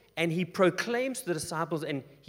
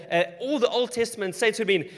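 usual speaking voice

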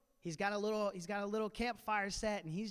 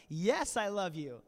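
insist, tired of being asked; emphatic slow answer; 3 semitones higher, 7 dB louder, slower than usual. Retold or imitated. Peter's third answer.

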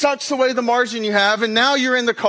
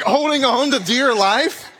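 ordinary talk, nothing marked

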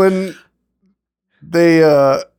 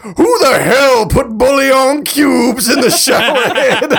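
angry yell; 7 semitones higher, slower than usual. Retold or imitated. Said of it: "And he's like".

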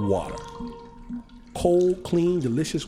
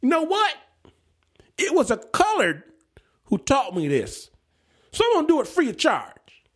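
mock loud boast, higher; booming it out to the dad, pitched up; 10 semitones higher than usual. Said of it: "he says to my father loudly".

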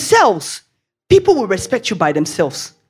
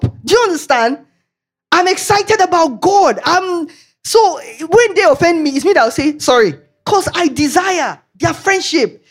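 higher, fired-up preaching; 7 semitones higher than usual. Real, not acted. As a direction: preach it with excitement. Preaching at full force.

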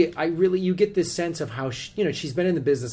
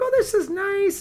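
high, slow sweet remark; sweet, pleasant voice. Retold or imitated. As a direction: say it gently charmed. Imitated reaction meeting her.

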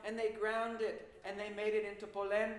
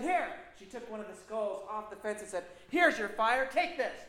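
harsh witch command; 8 dB louder than usual. Acted. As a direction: shove the skull at her. Witch thrusts skull at girl.

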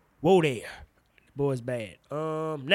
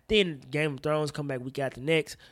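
ordinary delivery

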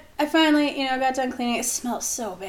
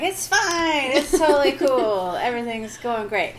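mock cheerful; 4 semitones higher, 3 dB louder than usual. Drunk false cheer.

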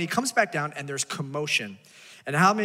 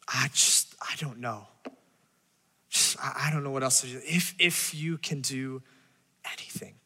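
hushed, broken, fading plea; desperate parent; 4 semitones lower, 5 dB quieter than usual. Retold or imitated. Pastor voices a parent out of options, hushed and breaking. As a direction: voice a worn-out parent with nothing left.

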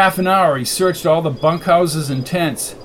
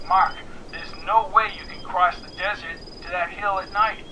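higher, thin phone voice; voice over a device; 3 semitones higher, 4 dB quieter than usual. A narrator's reading. Annie warns McKenna against the trip, thin phone voice. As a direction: object through a filtered line.

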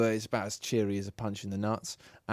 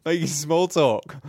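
ordinary talk

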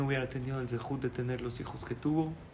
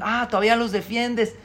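sharp, higher and louder mother-in-law jab; irritated accusation; 7 semitones higher, 8 dB louder than usual. Retold or imitated. Mother-in-law accusing the groom of defending his parents, sharp and loud. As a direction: catch him out, scornful. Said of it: "Su suegra se exaltó".

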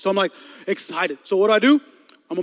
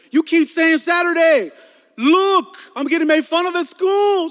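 put-on higher, louder whiny pleading boy; pleading kid; 9 semitones higher, 6 dB louder than usual. Son begging his dad for a haircut, whiny. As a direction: beg dad like an upset kid.

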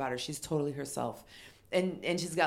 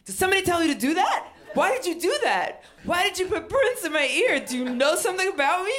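high, loud barrage of frantic questions; yelling; 13 semitones higher, 7 dB louder than usual. Retold or imitated. Overexcited fan yelling at the woman who played her Prince, frantic yell. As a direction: demand answers at a hysterical pitch. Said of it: "I yell at this woman".

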